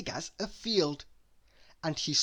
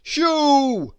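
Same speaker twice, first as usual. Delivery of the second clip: loud, higher burst to scare off a cat; big man's shoo; 11 semitones higher, 12 dB louder than usual. A narrator's reading. Mister Strong chasing Ginger away from Miss Tiny, loudly. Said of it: "said Mister Strong to Ginger".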